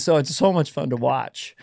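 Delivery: usual speaking voice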